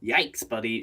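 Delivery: ordinary delivery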